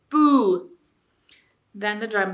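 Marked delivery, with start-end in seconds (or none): 0.1-0.7 loud, higher boo; jeering boo. A narrator's reading. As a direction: boo the king. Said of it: "sneered the dwarf".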